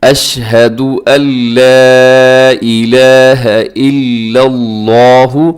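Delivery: slow, drawn-out recitation; measured teaching chant; tajweed recitation. Shown as a demonstration. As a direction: recite slowly with long held vowels. Recites for learners.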